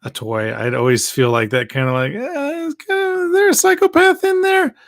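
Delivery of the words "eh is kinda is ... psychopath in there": mock high, unsettled inner voice; creeped-out thought. Recalling kids who wreck toys, a mock high creeped-out voice. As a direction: squeak it out like you've spotted a psychopath. Wary thought about toy breakers.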